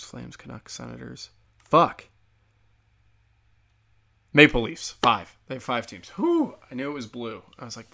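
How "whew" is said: high-pitched whew of relief; relieved whew. Real, not acted. Relief at recalling missing team.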